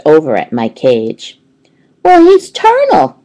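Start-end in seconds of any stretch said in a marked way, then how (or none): 2.0-3.2 put-on high, bright little-girl voice, much higher; chirpy high child voice; eager schoolgirl Heidi. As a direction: pipe up like a know-it-all kid. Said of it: "replied Raise Your Hand Heidi Hopper".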